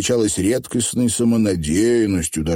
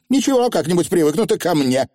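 high-pitched, breezy reply; breezy young witch; carefree; 11 semitones higher than usual. A narrator's reading. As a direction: toss it off lightly, pitched up. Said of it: "беспечно отозвалась анаграмма".

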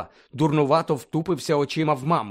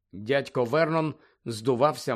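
ordinary delivery, nothing marked

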